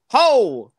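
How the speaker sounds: sharp Walken-style exclamation; Christopher Walken impression. Shown as a demonstration. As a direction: bark it like Walken. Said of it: "I could do a voiceover as him".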